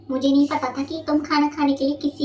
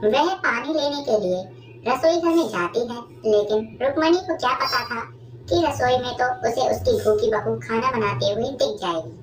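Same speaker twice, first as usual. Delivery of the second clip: ordinary talk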